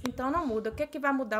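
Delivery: ordinary talk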